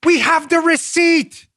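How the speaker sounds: high, slow and emphatic; exasperated, high-pitched rebuttal; exasperated insistence. Real, not acted. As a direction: spell it out in disbelief.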